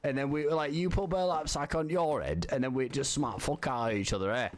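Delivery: hammed-up British lad accent, lightly done; troll as a cheeky British bloke; fake British accent. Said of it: "I'm fucking talking like him".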